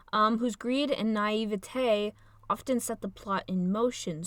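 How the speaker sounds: ordinary talk, nothing marked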